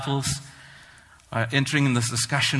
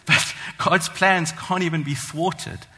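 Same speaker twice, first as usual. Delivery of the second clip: normal talking voice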